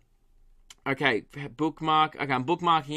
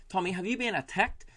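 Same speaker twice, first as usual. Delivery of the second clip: higher, softer mock Kiwi radio host; New Zealand accent; 4 semitones higher, 5 dB quieter than usual. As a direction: imitate a New Zealander's question. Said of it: "I've got it now".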